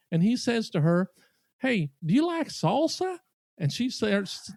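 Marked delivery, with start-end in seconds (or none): 1.6-3.2 put-on Southern accent. Southern diner to waitress about salsa, in a drawling accent. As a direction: imitate a friendly Southern diner asking. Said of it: "in this Southern accent".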